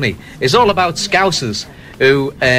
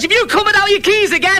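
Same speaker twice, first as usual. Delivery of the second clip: heckling Scouser; 13 semitones higher than usual. Acted. Scouser calls back to Ali Baba.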